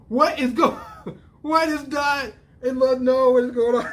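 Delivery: high-pitched laughing disbelief; cracking up, half shouting the question. Real, not acted. Viewer loses it at Dot's love line, laughing.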